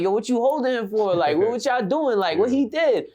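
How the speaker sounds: high-pitched retold protest; indignant challenge. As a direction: demand answers from police on a friend's behalf. Challenging police holding his friend.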